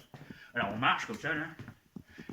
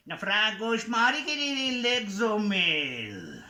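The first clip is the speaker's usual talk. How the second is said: mock magic spell; 5 semitones higher, 4 dB louder, much slower than usual. Magic formula to calm the pet.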